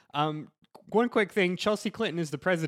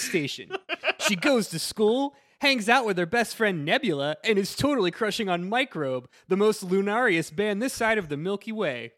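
higher, bouncy Disney trailer announcer; Disney trailer guy; 5 semitones higher, 5 dB louder than usual. Acted. Friend reading back of the VHS box on request, Disney trailer guy voice. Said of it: "your best Disney trailer guy voice".

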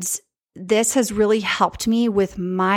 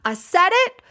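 excited; 10 semitones higher than usual. Real, not acted. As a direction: blurt it out proud of daring. Triumphant repeat of her confession.

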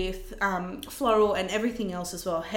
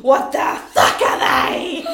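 loud, slow, voiceless devil growl; 10 dB louder, slower than usual. Retold or imitated. Bride seeing her flowers, imitated as a devil. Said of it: "Like a devil".

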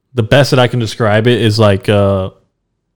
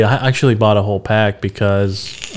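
ordinary delivery

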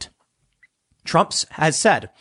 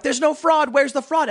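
higher mocking taunt; 10 semitones higher than usual. Mimicking leftists taunting about fraud. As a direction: sneer it like a gloating critic. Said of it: "these leftists are like".

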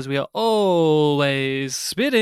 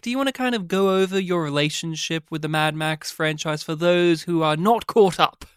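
usual speaking voice

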